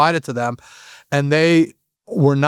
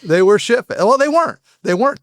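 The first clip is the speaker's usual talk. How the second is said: much higher, quick self-correction; 7 semitones higher than usual. Real, not acted. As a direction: catch yourself and correct it. Correcting himself.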